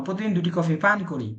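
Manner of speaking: normal talking voice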